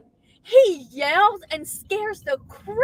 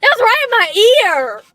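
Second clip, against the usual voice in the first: loud, raised, indignant protest; annoyed outcry; 5 semitones higher, 10 dB louder, slower than usual. Real, not acted. Wife protesting a yell in her ear, loud and indignant. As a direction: scold loudly after being startled.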